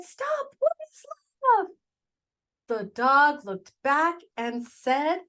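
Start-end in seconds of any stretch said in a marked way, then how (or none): 0.0-1.7 high, loud call after someone leaving; urgent loud call. Acted. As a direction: shout wait at someone running off. Calling after the departing dog.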